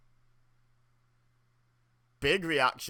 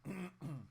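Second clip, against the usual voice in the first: throat clear; 5 semitones higher, 14 dB quieter than usual. Real, not acted. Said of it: "trying to clear my throat".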